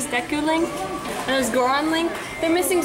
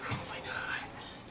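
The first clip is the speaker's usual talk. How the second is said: whispered; 19 dB quieter, much slower than usual. Real, not acted. Whispering in store filming.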